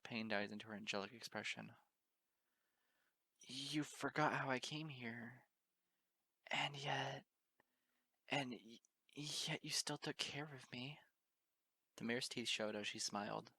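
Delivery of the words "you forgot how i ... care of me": tearful. Acted. Crying pony confesses.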